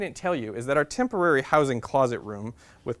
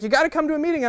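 enthusiastic invitation; 14 semitones higher, 6 dB louder, faster than usual. Retold or imitated. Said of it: "a very enthusiastic woman".

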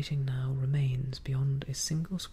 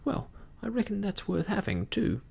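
put-on high, relieved young pirate; joyful; 7 semitones higher than usual. A narrator's reading. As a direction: take heart, pitch lifted. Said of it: "with a sort of joy".

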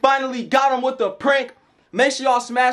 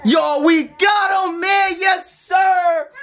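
triumphant shout; 8 semitones higher, much slower than usual. Real, not acted. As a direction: crow over the win. Celebrating successful prank.

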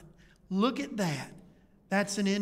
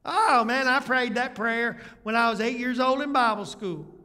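louder, higher brushing-off voice of a smug believer; mock complacent churchgoer; 3 semitones higher, 6 dB louder than usual. Preacher mocking the believer who prayed at eight years old, loud and higher. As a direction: play the churchgoer who thinks one childhood prayer settled it.

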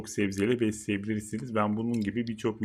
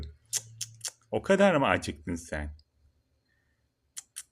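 tongue clicks; 3 semitones lower, much slower than usual. Real, not acted. Scolding the turtle for biting.

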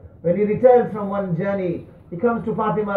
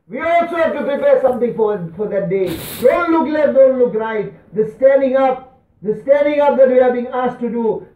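loud, high exhortation; emphatic rallying sermon voice; impassioned preaching; 5 semitones higher, 5 dB louder than usual. Acted. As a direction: urge the congregation forcefully. Preaches at full force.

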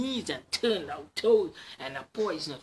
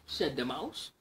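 ordinary delivery, nothing marked

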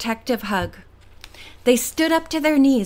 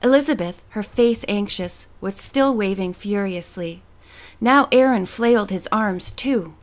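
plain reading voice

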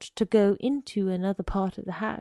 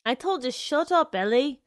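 loud, higher-pitched scolding; irritated rebuke; 5 semitones higher, 6 dB louder than usual. A narrator's reading. Arnold Friend telling Ellie to shut up, irritated. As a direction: tell him off, raised voice.

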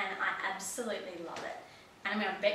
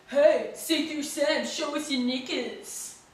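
high, loud, drawn-out mock taunt; imitated jeering yell; 6 semitones higher, 8 dB louder, slower than usual. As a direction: imitate a boy yelling from a passing bike. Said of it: "yells out on his bike".

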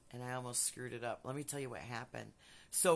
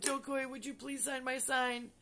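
much higher, sugary ask; sweet pleading fan; 11 semitones higher than usual. Retold or imitated.

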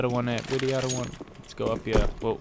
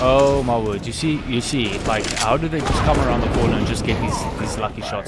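loud, exasperated outburst; frustrated rant; 8 dB louder than usual. Real, not acted. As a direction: yell in disbelief at the screen. Frustrated rant after lucky kill.

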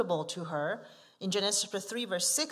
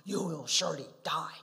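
stern voice of God; 4 semitones lower than usual. Acted. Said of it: "The stern voice of God".

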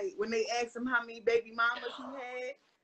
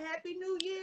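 higher-pitched, pointed retold greeting; pointed retold reply; 4 semitones higher, 5 dB quieter than usual. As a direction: quote yourself repeating it pointedly, pitched up. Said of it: "I said".

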